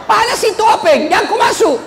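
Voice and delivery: hammed-up villager warning not to enter; mock yell. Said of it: "dia teriak".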